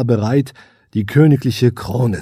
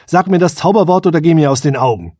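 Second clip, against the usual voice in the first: loud, fast angry demand; 5 semitones higher, 5 dB louder, faster than usual. A narrator's reading. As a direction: bark it out quickly. Prince snaps at elf.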